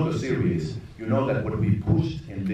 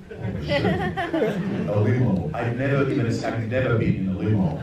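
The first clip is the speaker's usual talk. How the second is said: laughing disbelief; 2 semitones higher, 4 dB louder than usual. Retold or imitated. Director recalls producer offering a limo, laughing in excited disbelief. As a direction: giggle in giddy amazement at the news.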